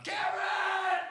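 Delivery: high, loud desperate-sounding shout; yell. Retold or imitated. Bleeding kid yelling at older brother, mock full shout. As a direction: scream a brother's name across the yard. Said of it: "So I yell at him".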